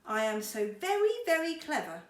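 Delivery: loud, proud boast. A narrator's reading. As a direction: puff up proudly. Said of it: "said Mister Clever proudly".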